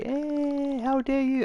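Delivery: high-pitched mocking outrage; mock hissy fit. Media Molecule throwing a hissy fit, high mock outrage. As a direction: squeal it like an offended prude. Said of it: "have their usual hissy fit and go".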